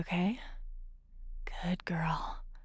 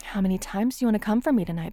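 fast and louder, eager; fast eager tease; 2 semitones higher, 6 dB louder, much faster than usual. Acted. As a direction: rush the question, teasing. Teasing partner in roleplay.

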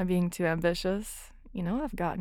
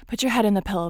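louder firm order; firm command; 2 semitones higher, 8 dB louder, much faster than usual. Acted. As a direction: snap the order out. Giving orders in roleplay.

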